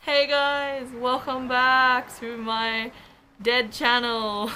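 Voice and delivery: bright, slow, drawn-out vlog hello; excited greeting. Real, not acted. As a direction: stretch out the welcome, upbeat. Vlog intro greeting to viewers.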